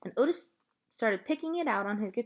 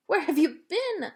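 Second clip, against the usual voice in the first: relieved call; 9 semitones higher, 6 dB louder, slower than usual. A narrator's reading. Girl finds her lost dog.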